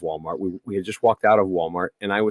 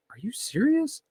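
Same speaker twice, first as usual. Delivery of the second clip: incredulous; 13 semitones higher, 7 dB quieter than usual. Retold or imitated. Retold argument with Karen.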